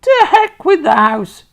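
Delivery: very high, very loud screechy crone whoop; wild old woman's shout. A narrator's reading. Grandma rising through the floor, screeching with glee. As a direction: whoop with mad joy. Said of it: "she shouted".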